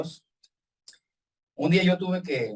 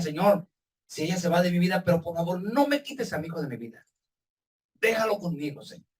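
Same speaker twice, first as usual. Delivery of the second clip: usual speaking voice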